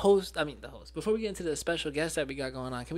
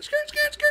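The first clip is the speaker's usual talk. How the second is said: skirt ad-lib; 6 dB louder, slower than usual. Retold or imitated. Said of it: "we be like".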